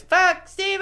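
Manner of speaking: high yelled chant; shouted rally cry. Acted. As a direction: whip up the crowd. Rallying kids in persona.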